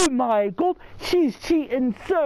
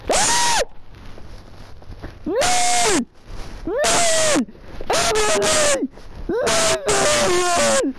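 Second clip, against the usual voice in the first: terrified screaming; 10 semitones higher than usual. Real, not acted. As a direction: shriek for help, trapped in the dark. Trapped in dark, screaming for help.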